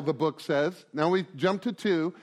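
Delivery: ordinary talk, nothing marked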